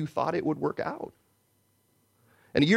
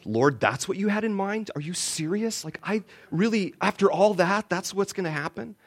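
incredulous complaint; 7 semitones higher than usual. Retold or imitated. Incredulous question to God.

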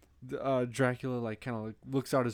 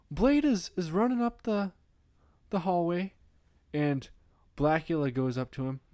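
normal talking voice

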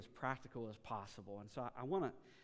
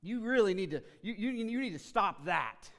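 loud, higher, scolding lecture; 6 semitones higher, 8 dB louder than usual. Retold or imitated. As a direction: scold someone without encouragement, loud and high. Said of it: "Somebody looks at you and says".

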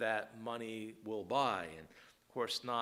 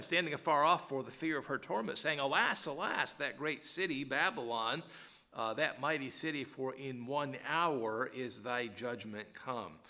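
louder, higher, lamenting King James reading; solemn scripture reading; 3 semitones higher, 4 dB louder than usual. Acted. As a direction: give the alas lines full weight. Reads scripture aloud.

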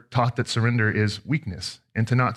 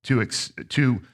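prayer; 3 semitones lower than usual. Acted. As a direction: pray over the congregation gently. Opening prayer before sermon.

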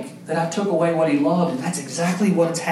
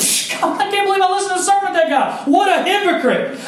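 high, fast mocking scoff; 13 semitones higher, faster than usual. The world scoffing at a disgraced pastor, mocking scoff. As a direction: sneer at the fallen pastor, quick and high. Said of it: "the rest of the world scoffs and says".

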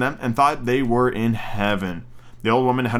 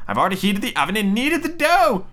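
put-on high old-woman voice; old witch; 8 semitones higher than usual. Witch readying the oven for Gretel, high voice. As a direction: announce it high, lifting toward the end. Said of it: "said the old woman".

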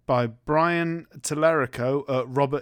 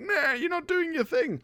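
high indignant mock retort; father snapping back at the police, mock indignant; indignant Cage. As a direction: scoff at the useless police. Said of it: "And it's like".

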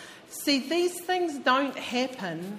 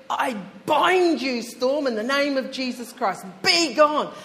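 commanding rebuke; 3 semitones higher, 6 dB louder than usual. Retold or imitated. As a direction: order the storm away with authority. Keith rebuking the storm.

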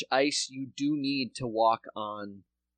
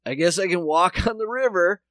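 high, loud mock dimwit voice; mock clueless city dweller; 7 semitones higher, 7 dB louder than usual. Mocking New Yorkers who think the river is safe, clueless voice. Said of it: "I was like".

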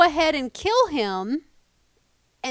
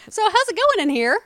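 lightly voiced breezy hero, noticeably higher and louder; mock casual Ichabod; 10 semitones higher, 7 dB louder than usual. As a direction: play it cheeky and innocent as he bursts in. Ichabod barges into chamber.

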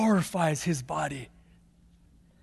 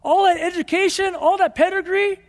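incredulous; 9 semitones higher, 3 dB louder than usual. Pretend. Preacher mock-questions Paul's choice.

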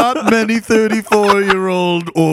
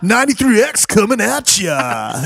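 a hint of a morning radio host; 5 semitones higher than usual. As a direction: hype it like a station ident. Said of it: "radio D J voice".

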